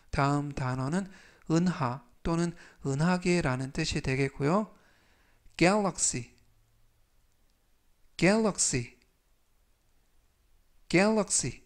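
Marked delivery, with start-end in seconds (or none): 5.6-11.6 galaxy said clearly, three times; 또박또박 galaxy 발음 시범; demo pronunciation. Shown as a demonstration. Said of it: "발음하시면 되겠습니다". (또렷하게 galaxy 시범)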